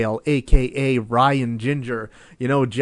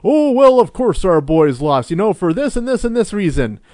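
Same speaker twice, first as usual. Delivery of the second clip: mock heritage narrator; 6 semitones higher, 4 dB louder than usual. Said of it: "that was a hilarious one".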